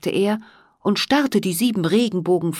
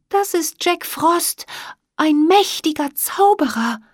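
put-on young fairy voice; 10 semitones higher than usual. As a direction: say it like a wide-eyed young fairy. Emma voiced answering.

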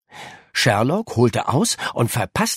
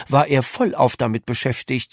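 ordinary delivery

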